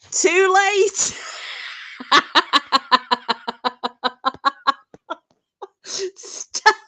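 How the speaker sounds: high, loud gleeful tease. Real, not acted. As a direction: crow it out delighted. Gleeful reaction to embarrassing chat.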